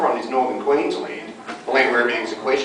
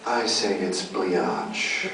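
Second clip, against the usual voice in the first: catchphrase voice; 7 semitones lower, 8 dB quieter, much slower than usual. Shown as a demonstration. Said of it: "Could you say".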